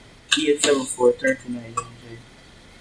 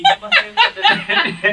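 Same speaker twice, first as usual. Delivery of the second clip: drawn out slowly through a laugh; much slower than usual. Real, not acted. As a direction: stretch it slow and laugh it out. Repeating the phrase for a laugh.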